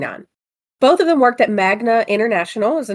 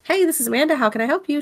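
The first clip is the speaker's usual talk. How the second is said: phone greeting voice; 6 semitones higher, faster than usual. Retold or imitated. Answering the phone at work.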